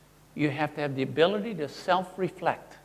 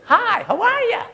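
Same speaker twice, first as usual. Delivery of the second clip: much higher and louder, a chirpy greeting; 9 dB louder than usual. Shown as a demonstration. Speaker showing a voice that clashes with a serious face, chirpy high. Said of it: "but your voice goes".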